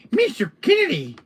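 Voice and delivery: high-pitched, teasing little villain; squeaky mock-villain greeting; annoying little voice. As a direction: pipe up in a silly high voice. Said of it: "who's doing the Salazar voice?".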